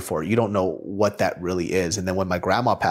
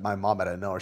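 ordinary talk, nothing marked

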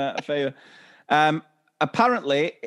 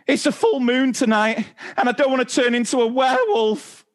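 put-on high, louder panicked kid voice; mock child's scream; 9 semitones higher, 4 dB louder than usual. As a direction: wail it like a scared child. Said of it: "looks up at me and screams".

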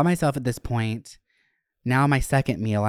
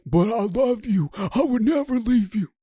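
much higher imitated plea; mock pleading boyfriend; 11 semitones higher than usual. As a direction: play the boyfriend swearing he'll stay. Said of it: "And he's like".

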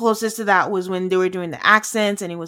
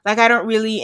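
ordinary talk

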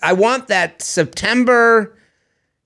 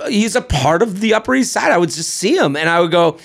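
ordinary talk, nothing marked

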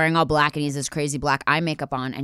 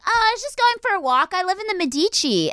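put-on naive girl; 13 semitones higher than usual. Her naive self retold.

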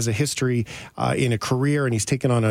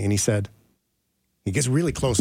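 ordinary talk

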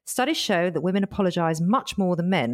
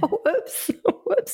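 laughing; 13 semitones higher, slower than usual. Acted. Caught apologising, laughs.

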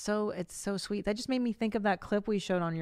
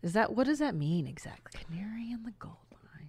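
whispering; 3 semitones higher than usual. Real, not acted. Puzzled aside about the saying.

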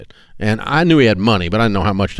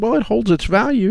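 higher, defensive excuse; defensive young self; 12 semitones higher than usual. Retold or imitated. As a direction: claim it weakly in a higher voice. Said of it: "I said".

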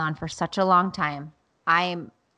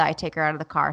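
ordinary talk, nothing marked

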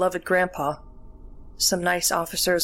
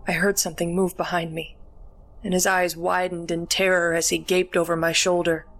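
plain reading voice

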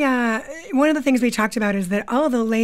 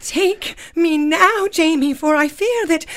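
high, melodramatic romance heroine; Claire; 4 semitones higher, 3 dB louder than usual. Acted. Claire fears soldiers will seize Jamie, melodramatic heroine. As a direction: plead with overblown passion. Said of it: "we wrote this sketch based on Outlander".

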